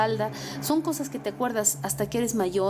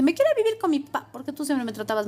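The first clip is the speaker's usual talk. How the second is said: put-on whiny teen; 3 semitones higher, faster than usual. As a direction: blurt it out like a sulky teen. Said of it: "pasa que en la adolescencia".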